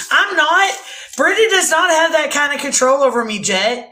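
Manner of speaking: loud, high-pitched objection; indignant protest. Real, not acted. Protesting to Jet about Brenda's control, indignant. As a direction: push back hard, voice jumping up. Teased about finding a man.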